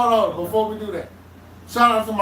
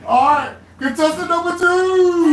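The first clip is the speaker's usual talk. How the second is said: loud, high, slow announcer call; game show host; 8 semitones higher, 6 dB louder, slower than usual. Acted. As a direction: announce the next contestant. Host announces next contestant.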